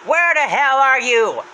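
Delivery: high, drawn-out shout; Happy's voice. Retold or imitated. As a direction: yell it slowly into an empty room. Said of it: "notices it's empty and says".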